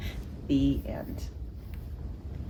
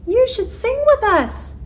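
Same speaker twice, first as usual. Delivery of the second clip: much higher, louder bird voices; chirpy birds; 6 dB louder than usual. Acted. The birds inviting Jim to sing, high and chirpy.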